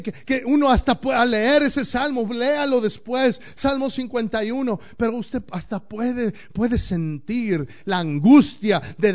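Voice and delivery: loud fiery sermon voice; forceful preaching. Acted. Pastor drives the point home to the congregation, forceful preaching. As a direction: preach it out hard.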